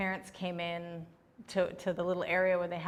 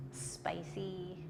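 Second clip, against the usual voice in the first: soft, voiceless single word; hushed, playful aside; playful whisper; 9 dB quieter than usual. Real, not acted. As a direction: whisper it after a teasing pause. Playful answer after a long pause.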